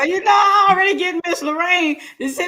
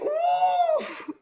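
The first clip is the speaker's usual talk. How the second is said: delighted ooh; 8 dB quieter than usual. Real, not acted. Relishing deviled eggs.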